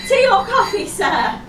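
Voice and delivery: high, loud start dropping on the last word; cabin crew service voice. Acted. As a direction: call out the drinks offer over turbulence. Serving during turbulence.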